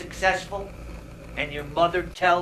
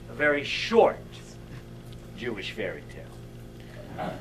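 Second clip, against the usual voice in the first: hushed repeat; 7 semitones lower, 7 dB quieter than usual. Shown as a demonstration. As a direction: drop it to a low, quiet aside. Repeats the title for effect.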